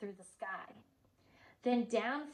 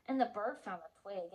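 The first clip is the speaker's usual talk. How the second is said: usual speaking voice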